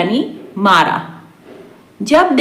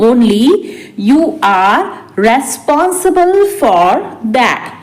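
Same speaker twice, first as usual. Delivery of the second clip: spaced out for learners; slow repeat. Shown as a demonstration. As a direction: pause after the first word. Teacher repeats translation word by word. (धीरे-धीरे, रुक-रुककर)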